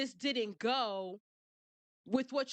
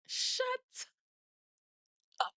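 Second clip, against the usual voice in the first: mock shut up; 12 semitones higher than usual. Telling off the lawyer.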